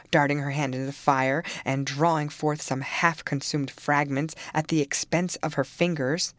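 plain reading voice

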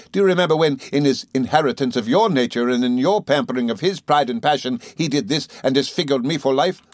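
furious accusation. Acted. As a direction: hurl it at the mother like a curse. Rosa attacking grieving mother.

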